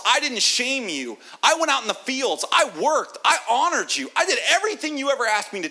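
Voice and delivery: high, loud, aggrieved rant; bitter son shouting his record at his father; resentful son. Acted. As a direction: pour out every grievance, rising. Older son listing his deeds.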